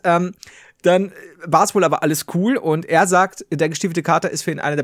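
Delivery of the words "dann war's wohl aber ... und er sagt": normal talking voice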